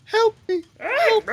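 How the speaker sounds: high, desperate cries for help; damsel in distress. Pretend. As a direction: shriek for help like a helpless maiden. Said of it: "sound like a, a damsel in distress".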